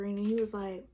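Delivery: ordinary delivery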